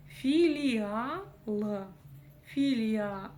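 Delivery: «Филиал» растянуто, «фи-ли-ал»; раздели «Филиал» на отчётливые слоги; показ по слогам. Shown as a demonstration. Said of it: "Тоже здесь нет ничего сложного". («Филиал» медленно, с долгим «а»)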